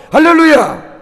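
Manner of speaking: preacher shouting hallelujah at the climax; fervent hallelujah. Real, not acted. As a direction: cry it out in fervour.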